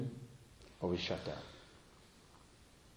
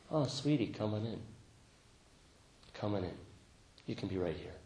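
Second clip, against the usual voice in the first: tender welcome; 8 dB quieter than usual. Acted. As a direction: coax it in gently and quietly. Tenderly welcoming one's own resistance.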